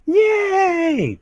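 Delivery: very high squeal of joy; excited. Real, not acted. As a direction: squeal it like a cheer. Cheering partner's level up.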